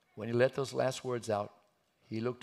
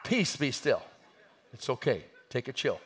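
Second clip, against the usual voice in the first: raised voice settling a panic; calling for calm; 6 dB louder than usual. Acted. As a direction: loudly tell a frightened room to settle. Jesus calming the startled disciples.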